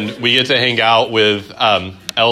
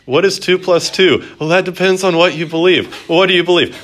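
higher, louder, sing-song mocking voice; mock relativist; 9 semitones higher, 6 dB louder than usual. Teacher mocking a relativist answer to the class, sing-song. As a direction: parody a wishy-washy answer.

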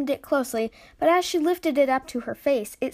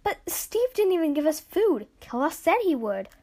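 higher-pitched complaint; put-out, raised-pitch objection; indignant protest; 6 semitones higher than usual. Acted. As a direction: protest the broken promise. Ash protests about missing food.

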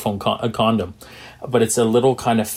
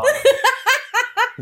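high burst of laughter. Real, not acted. Laughs at his own line.

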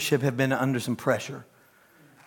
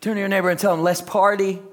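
preaching; 10 semitones higher, 4 dB louder than usual. Acted.

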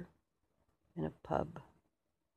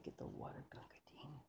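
weak whisper; 2 semitones lower, 15 dB quieter, faster than usual. Acted. Wounded soldier begging for water.

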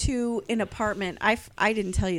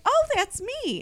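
high, chirpy mock brightness; a hint of fake cheer; bright own-voice reply; 14 semitones higher than usual. Answering the vendor, high and chirpy. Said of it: "I'm like".

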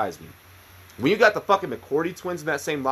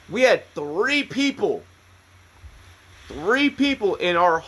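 usual speaking voice

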